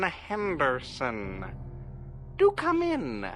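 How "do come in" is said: ordinary delivery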